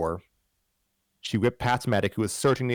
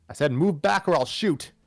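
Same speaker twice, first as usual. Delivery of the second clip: higher, threatening demand; demanding villain; 8 semitones higher, 3 dB louder than usual. Acted. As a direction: threaten him, gun raised. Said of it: "The woman demanded".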